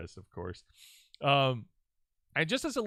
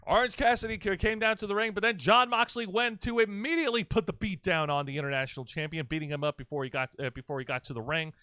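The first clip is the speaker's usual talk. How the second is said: ordinary talk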